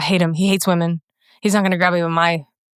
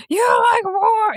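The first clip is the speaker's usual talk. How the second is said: much higher, slow mock outrage; mock shrieking critic; slower than usual. Mocking critics who attacked her, hammed-up high slow wail. Imitating online critics again.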